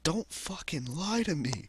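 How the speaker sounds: high, slow, drawn-out mock indignation; mock protest. Him answering her compliment, hammed-up high protest. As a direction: play up the protest in a put-on high voice.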